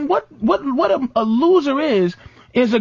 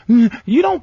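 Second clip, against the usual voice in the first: usual speaking voice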